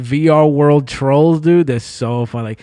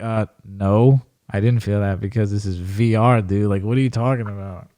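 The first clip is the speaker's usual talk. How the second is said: mock bored troll; 7 semitones lower than usual. Troll answering the VR user, low mock dismissal. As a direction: brush him off like he's nuts.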